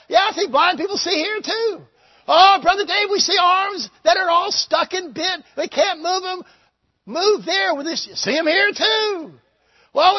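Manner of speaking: loud, high, drawn-out revival shouting; full-force preaching. Acted. Preacher acting out church folk's reports of healings, shouted preaching. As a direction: voice both sides at a preacher's full cry.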